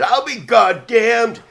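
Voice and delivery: put-on high, slow, drawn-out old-timer amazement; astonished old man. Old Roy amazed the boy would leave, slow and high. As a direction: stretch it out like a stunned old-timer.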